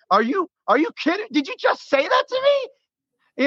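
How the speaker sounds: outraged disbelief, each word drawn out high; retold livid disbelief. Fan retelling the quarterback's snub, high slow incredulous outrage. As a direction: pitch shooting way up, slow and incredulous. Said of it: "and I'm livid".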